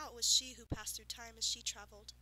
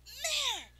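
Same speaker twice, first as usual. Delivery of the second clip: high, loud outraged cry; indignant demand; 13 semitones higher, 9 dB louder than usual. Acted. Unicorn demanding an answer from the farmer, high and loud. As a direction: hurl the word back at him. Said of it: "she demanded".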